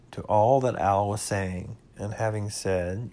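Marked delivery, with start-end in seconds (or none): none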